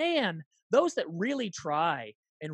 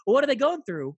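probing; 7 semitones higher, 6 dB louder than usual. Retold or imitated. Acted probing gossip friend.

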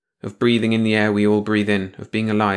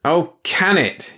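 tired gruff snap; weary growl; 9 semitones higher, 4 dB louder, much slower than usual. Acted. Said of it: "he growled wearily".

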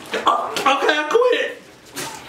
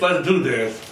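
ordinary delivery

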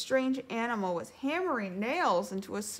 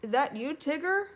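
mild call, rising higher on the name; 7 semitones higher, 4 dB louder than usual. A narrator's reading. Pooh calling to whoever knocks, calling out. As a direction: call through to whoever is outside. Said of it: "called Pooh".

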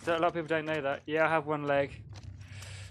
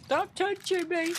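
very high, drawn-out playful whine; squeaky mock protest; 13 semitones higher, slower than usual. Playful cartoon-style outburst during game banter.

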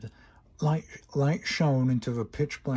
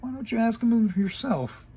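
upset exchange; 10 semitones higher than usual. Acted. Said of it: "Twilight said angrily".

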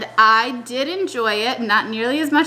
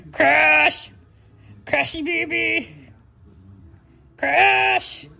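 high sing-song pet call; 4 semitones higher, much slower than usual. Real, not acted. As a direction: coo the name high and drawn out. Calling the pet by name.

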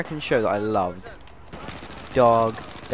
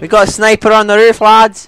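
loud, higher warning yell; alarmed shout; 9 semitones higher, 11 dB louder than usual. Real, not acted. Player yelling to his squad about a rooftop sniper. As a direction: shout the warning across the map.

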